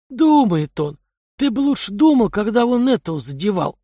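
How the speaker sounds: a streetwise thug scoffing; gruff thug voice. Acted. Kai's voice in dialogue.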